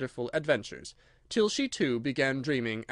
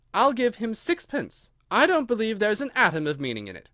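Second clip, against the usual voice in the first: put-on defiant girl; 7 semitones higher, 4 dB louder than usual. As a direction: dare them, bright and bold. Alice defiant in court.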